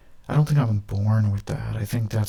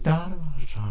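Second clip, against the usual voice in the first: imitated gunfire; 7 semitones higher than usual. Imagined gunman firing imitated.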